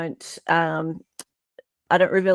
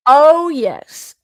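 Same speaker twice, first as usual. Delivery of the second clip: gleeful oh yes; 7 semitones higher, 8 dB louder than usual. Pretend. Gleeful mock reply.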